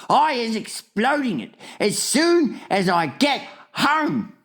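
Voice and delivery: high, slow, emphatic warning; dramatic giant dreading the consequences; distressed giant. A narrator's reading. Distressed giant figure warns.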